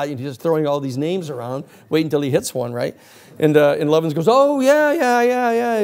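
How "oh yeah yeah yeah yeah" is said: high, loud string of dawning yeahs; feigned recognition. Acted. Man bluffs to a forgotten acquaintance, high gushing recognition. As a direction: bluff that you suddenly remember him.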